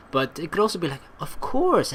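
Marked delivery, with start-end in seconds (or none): 1.2-1.9 gentle, caring. Shown as a demonstration. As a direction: lift "Of course" high to sound caring. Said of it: "in a gentle, caring way".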